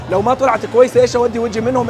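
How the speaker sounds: ordinary talk, nothing marked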